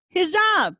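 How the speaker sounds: loud, high, outraged; indignant exclamation. Real, not acted. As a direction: throw the words out in disbelief. Outraged repeat of own words.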